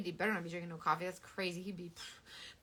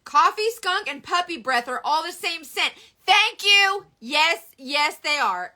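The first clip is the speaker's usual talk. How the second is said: excited rant; 7 semitones higher, 12 dB louder, slower than usual. Real, not acted. Ranting about smells, excited.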